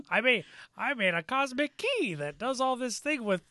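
high silly mock voice; mocking character voice. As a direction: lampoon the clueless inventor. Mocking Gwildor's explanation.

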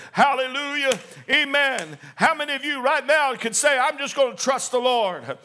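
full-force preaching to the congregation. Acted. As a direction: build to a shout of trust. Preaching climax on trust.

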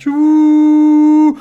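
high, loud swoosh; whooshing crane noise. Acted. Crane lifts Theobald with a loud high whoosh. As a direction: sweep it up like a crane.

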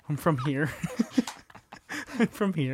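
quiet mock awkwardness; deflated retold reply. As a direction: answer meekly, half laughing.

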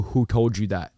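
low, soft questioning uncle; imitated uncle. Uncle questioning his nephew's revelations, low imitated voice. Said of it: "My uncle would sit there and go".

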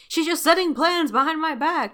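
whiny retold grievance; frustrated complaint. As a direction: imitate a put-out man griping. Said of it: "why he's frustrated".

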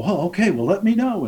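mock-polite customer voice, pitched well up; breezy brush-off. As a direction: toss it off lightly, already half out the door.

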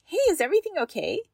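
high, quick caring question; gentle check-in. Shown as a demonstration. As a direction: toss it out lightly, as a surprise. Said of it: "And ask".

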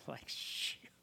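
very soft whispered reaction; whispered dismay. Retold or imitated. Buyer reacts to mentor's verdict, whispered dismay. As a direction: whisper it as the bad news lands.

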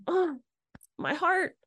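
touched. Retold or imitated. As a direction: melt at the kind words, pitch lifted high. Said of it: "And I was like".

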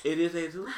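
"Jesus" said as hay-SOOS; Spanish pronunciation. Shown as a demonstration. Co-host confirms the cult's name, Spanish hay-SOOS. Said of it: "Jesus' name is not Jesus".

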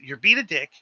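rising from firm to a loud, high bye; building angry retort. Retold or imitated. Cook telling off the stressed chef, building to a loud shout. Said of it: "I said".

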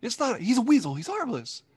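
lightly voiced high impression; high mock voice. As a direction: squeak out the reassurance. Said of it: "He's like".

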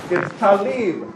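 "talib" said slowly, TA-lib; slow model of Arabic "talib"; Arabic word. Shown as a demonstration. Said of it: "If you say this in the Arabic".

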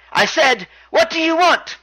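high, insistent mock demand; Neville, exasperated. Champion repeats himself to challenger, high imitated exasperation. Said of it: "Then Neville says".

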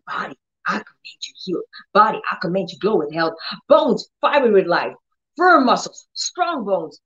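rapid, hushed string of commands; fast quiet commands. Shown as a demonstration. Discreet commands to the body in the toilet, fast and hushed. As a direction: rattle off commands quietly under your breath. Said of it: "You hear how I'm talking?".

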